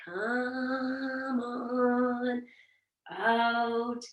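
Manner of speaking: slow and lingering, loving the cue; drawn-out slow. Shown as a demonstration. Teacher to class, a lovingly slow come on out. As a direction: stretch each word out, savouring it. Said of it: "I'm gonna say it so slow 'cause I love this".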